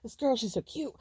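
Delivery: mock-bragging to the guys, then looking around for Stephanie; imitating giddy Brian. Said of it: "he's, like, going around".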